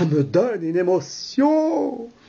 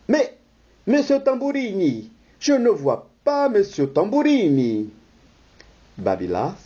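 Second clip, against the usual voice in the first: usual speaking voice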